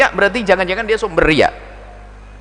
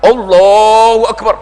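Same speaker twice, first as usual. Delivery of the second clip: slow, louder Allahu Akbar called out; a man praying loudly so everyone hears; showy loud prayer; 3 semitones higher, 5 dB louder, slower than usual. Retold or imitated. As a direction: pray so the neighbours hear.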